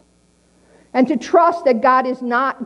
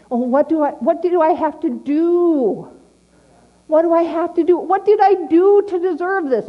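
self-pitying whine; 6 semitones higher than usual. Retold or imitated. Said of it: "Man, that's the one thing".

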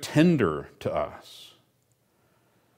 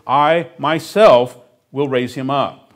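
"myself" punched loud and slow, falling; preaching voice, "myself" stressed hard and loud; 5 semitones higher, 11 dB louder, slower than usual. Shown as a demonstration. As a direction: drive home "myself" as the only one. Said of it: "it's emphatic".

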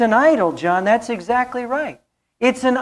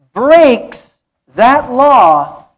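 slow, much louder on breaks; preached emphasis; 4 semitones higher, 9 dB louder, much slower than usual. Real, not acted. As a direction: hammer out breaks that law. Preaching climax.

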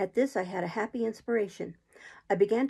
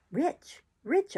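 rising higher and higher, heated; hotly insisting; 8 semitones higher than usual. A narrator's reading. Marooned Ben Gunn insisting to Jim he is rich, heated and rising. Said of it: "repeated the statement hotly".

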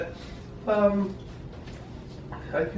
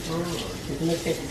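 usual speaking voice

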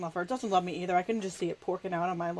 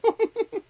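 giggle; 13 semitones higher, 6 dB louder than usual. Real, not acted. Giggles after eating the chocolate.

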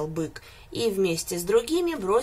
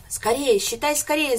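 higher, louder urging cries; shouting together; 5 semitones higher, 5 dB louder than usual. A narrator's reading. Animals urge the goat to count, shouting. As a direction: hurry him with a shout. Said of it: "закричали все хором".

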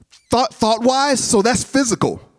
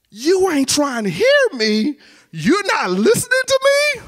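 wife's complaint; 9 semitones higher than usual. Retold or imitated. Wife voiced hurt.

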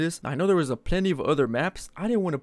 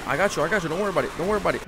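ordinary talk, nothing marked

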